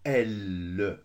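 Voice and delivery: "L" pressed loud with a long held l sound; leaning on L. Shown as a demonstration. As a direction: lean hard on "L", stretching the consonant. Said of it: "J'appuie sur le L". (« L » très appuyé, consonne prolongée)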